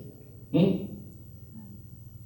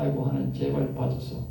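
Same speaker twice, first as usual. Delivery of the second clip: retold pained plea; 14 semitones lower, 5 dB quieter than usual. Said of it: "오 분도 안 울었는데 배창자가 너무 아파".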